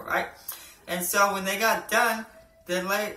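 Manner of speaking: ordinary talk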